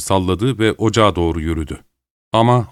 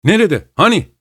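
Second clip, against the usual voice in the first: high, raised-voice questions; loud questioning; 11 semitones higher, 4 dB louder than usual. A narrator's reading. Joe asking Malcolm where the visitor is, loudly. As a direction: demand to know, voice jumping up. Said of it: "diye yüksek sesle sordu Joe".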